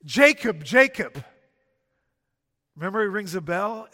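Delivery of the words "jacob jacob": louder, solemn double call of "Jacob"; God calling by name. Shown as a demonstration. God summoning Jacob to get his attention, loud solemn call. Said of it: "he talks like this".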